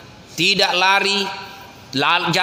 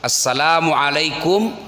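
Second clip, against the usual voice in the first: full complete greeting; 6 semitones lower than usual. Shown as a demonstration. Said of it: "salamnya orang Islam, standar, lengkap, ikhlas".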